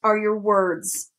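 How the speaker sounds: slow, drawn-out disdain; mildly scornful, stretched-out words; disdainful. A narrator's reading. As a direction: hand it over with slow disdain.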